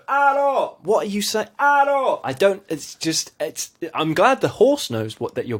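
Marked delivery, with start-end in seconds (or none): 0.1-0.8 high, loud call of Arrow; calling horse. Retold or imitated. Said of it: "then he'll go". 1.6-2.2 high, loud call of Arrow; calling horse. Retold or imitated. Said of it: "then he'll go".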